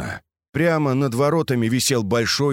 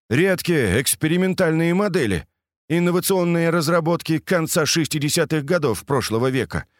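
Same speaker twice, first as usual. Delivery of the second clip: lightly voiced enthusiast, higher than narration; keen tank buff; 7 semitones higher than usual. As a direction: rattle off the facts with eager expertise. Character explains tanks keenly. (увлечённый знаток, повышенным голосом)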